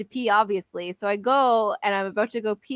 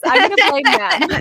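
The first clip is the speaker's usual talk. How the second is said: high, louder playful blame with laugh; laughing joke; 9 semitones higher, 7 dB louder than usual. Real, not acted. Joking to friends she'll blame her brother, laughing.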